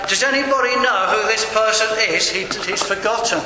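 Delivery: imitated clerk appealing to the whole room; very loud inquiry. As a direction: call it out for everyone to hear. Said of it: "she inquired very loudly".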